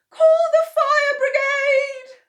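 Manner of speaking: high, loud, slow, half-sung cry for help; frantic cry. Acted. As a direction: shout for the fire brigade in a high, tearful voice. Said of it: "cried Missus Cranky".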